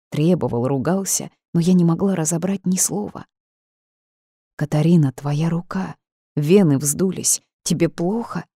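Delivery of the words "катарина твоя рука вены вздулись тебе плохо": ordinary delivery, nothing marked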